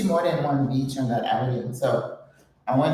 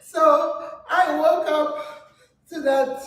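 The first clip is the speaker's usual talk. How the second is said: higher, slow amused line; 11 semitones higher, slower than usual. Real, not acted. Telling viewers how her morning began, amused. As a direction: linger on it, still amused.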